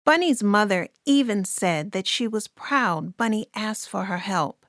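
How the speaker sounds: ordinary delivery, nothing marked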